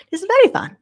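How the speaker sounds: a gleeful high exclamation; delighted burst. Acted. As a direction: let the fun spill out loudly.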